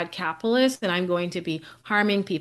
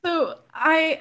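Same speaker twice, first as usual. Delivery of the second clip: laughing; 9 semitones higher, 4 dB louder, much slower than usual. Real, not acted. Laughing after shared joke.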